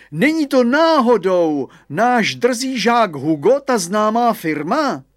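high, loud, slow schoolmaster voice; stern headmaster. Acted. Headmaster spotting his cheeky pupil, slow loud high voice. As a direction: boom it like a pompous old headmaster.

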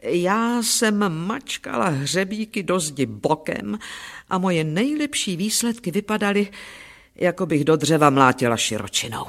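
ordinary delivery, nothing marked

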